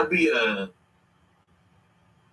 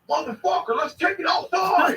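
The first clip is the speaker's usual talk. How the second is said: mock challenge; 10 semitones higher than usual. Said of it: "I never heard no woman to call me outside".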